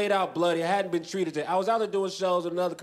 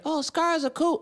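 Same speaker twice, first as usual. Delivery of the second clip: high, lightly mocking admirer voice; chirpy admirer; 10 semitones higher than usual. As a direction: mimic an admirer, pitched up. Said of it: "But some people say".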